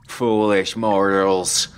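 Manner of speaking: slow, loud, theatrical vampire lord; grand vampire. A narrator's reading. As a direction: sneer it like a stage Dracula. Billy plays a vampire lord.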